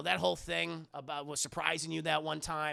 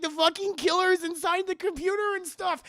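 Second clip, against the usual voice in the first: high, loud frantic cry; panicked Sydney; 12 semitones higher, 7 dB louder than usual. Acted. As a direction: shriek it out like she's lost it. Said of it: "She's like".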